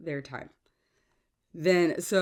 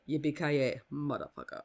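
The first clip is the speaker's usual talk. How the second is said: Hans's way; 2 semitones lower, 5 dB quieter than usual. Acted. Podcaster quoting the film's villain for listeners, lightly imitated Hans. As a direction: say it as Hans does, r's dropped. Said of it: "or as Han says it".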